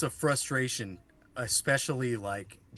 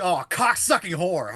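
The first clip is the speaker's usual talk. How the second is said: loud, slow, higher-pitched cursing; road-rage curse; 6 semitones higher, 7 dB louder, slower than usual. Acted. Showing friends a favourite car curse, acted outburst. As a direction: draw the curse out at a bad driver. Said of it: "I'll be like".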